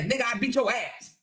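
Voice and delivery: hammed-up braggart taunting his rival; lightly voiced mock trash talk; mocking Kevin. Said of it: "I was like".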